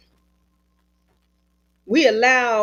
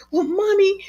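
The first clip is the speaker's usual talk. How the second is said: put-on high little-girl voice; daughter's voice; 10 semitones higher than usual. Mother retelling, voicing her little girl. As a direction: play the small daughter pleading. Child voiced in retold story.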